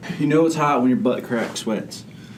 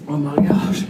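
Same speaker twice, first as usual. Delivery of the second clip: ordinary talk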